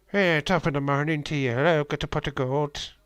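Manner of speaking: loud, high stage-Irish leprechaun voice; mock Irish accent. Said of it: "Stereotypified the entire Irish nation".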